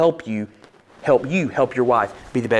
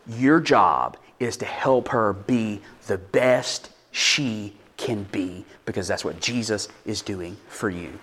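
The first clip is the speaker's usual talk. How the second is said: slower, softer sincere telling; tender, sincere; 4 semitones lower, 6 dB quieter, slower than usual. Real, not acted. Preacher telling husbands their job, soft and slow. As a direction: ease down and mean it.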